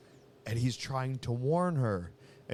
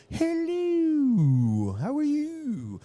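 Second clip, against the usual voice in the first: loud falsetto, vowels stretched long; sing-song it very high and slow as a talking belly; silly belly voice; 7 dB louder, much slower than usual. Retold or imitated. Demonstrating the belly's voice from Seinfeld, silly high voice. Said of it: "they were doing this little voice".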